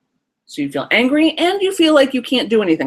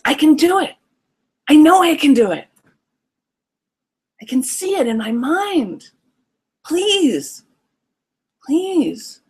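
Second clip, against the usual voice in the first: put-on determined, pleading kid; 7 semitones higher, slower than usual. Inner child begging the nervous parent, high and pleading. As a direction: insist then plead like a small child. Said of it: "Kid says".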